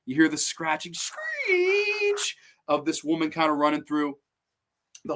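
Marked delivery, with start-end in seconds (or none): 0.9-2.4 high, drawn-out scraping screech; imitated screech. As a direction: stretch the word into a high screech. Imitates the scratching noise.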